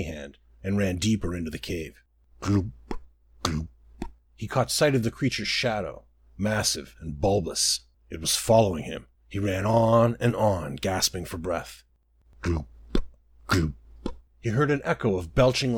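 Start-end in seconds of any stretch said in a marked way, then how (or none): 2.4-3.7 wet, gulping gloop; a thick bubbling gloop; slimy swallowing gloop; gloop sound. A narrator's reading. Creature's wet gloop noise. 12.4-13.7 wet, gulping gloop; a thick bubbling gloop; slimy swallowing gloop; gloop sound. A narrator's reading. Creature's wet gloop noise.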